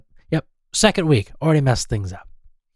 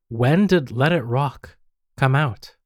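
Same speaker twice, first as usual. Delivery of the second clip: drawn-out, deliberate query with pauses; slow, careful question. Real, not acted. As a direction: spell it out slowly for the voice assistant. Repeating question for the voice assistant.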